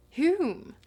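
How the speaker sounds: plain reading voice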